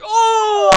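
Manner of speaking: loud, high bawl, played up; mock crying wail. Little brother hit by older brother, hammed-up wail. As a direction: imitate a beaten kid starting to howl. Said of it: "start crying and things like that".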